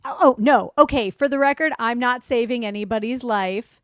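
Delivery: oh no, not a hero; emphatic loud denial. Real, not acted.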